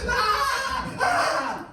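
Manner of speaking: high-pitched comic shrieks; hammed-up wails of agony; mock screaming. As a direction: wail high like an injured cartoon egg. Humpty acted lying on ground.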